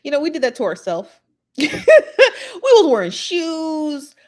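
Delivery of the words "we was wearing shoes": high, loud, drawn out through laughter; laughing exclamation. Real, not acted. Recalling lax airport security, laughing in disbelief. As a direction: stretch it out slowly, cracking up.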